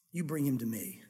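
low, quiet, grim order from the king; low stern command. Acted. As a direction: say it low and heavy, a king holding back anger. King orders the servant brought.

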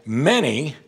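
Many leaned on and drawn out; preacher underlining Many for the congregation; stress on many. Shown as a demonstration. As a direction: lean on the word Many so nobody misses it. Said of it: "don't miss that word".

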